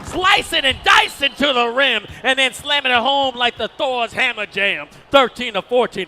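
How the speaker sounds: loud, driving play-by-play shout; excited dunk call. Real, not acted. Commentator calls a slam dunk, excited shout. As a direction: belt out the slam for the crowd.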